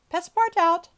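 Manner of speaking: high, very loud roll call. A narrator's reading. Judge summoning the servant, calling out loudly. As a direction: sing out the name across the room. Judge calls out second accused.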